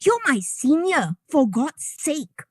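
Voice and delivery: slow exasperated scolding. Real, not acted. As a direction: drag it out slowly in disbelief.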